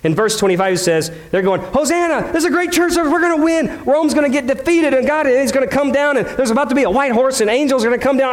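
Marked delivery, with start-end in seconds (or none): 1.6-8.3 much higher, eager voice of a cheering crowd; excited crowd cheer. Retold or imitated. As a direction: sound like the hopeful crowd expecting victory. Crowd cheering Jesus at entry.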